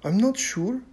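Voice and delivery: put-on a young girl, hesitant; Ren's voice. A narrator's reading. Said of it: "Ren said".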